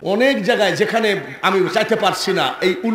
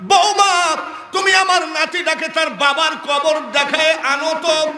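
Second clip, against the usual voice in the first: high, forceful call to a daughter-in-law; 12 semitones higher than usual. Retold or imitated. Grandfather summons his daughter-in-law about the grave, calling out high. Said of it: "ডাক দিয়ে কয়".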